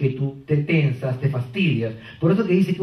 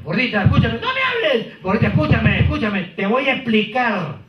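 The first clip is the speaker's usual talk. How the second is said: high, pleading voice; pleading boyfriend; 6 semitones higher than usual. Acted. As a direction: beg her to listen. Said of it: "si él le dice".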